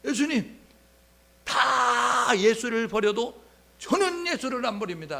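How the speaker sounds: high, loud, slow proud declaration; boastful vow. Retold or imitated. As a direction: swear it with neck veins bulging. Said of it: "목에 핏대 세우고 이야기했어요". (목에 핏대 세우고 크게 장담하듯)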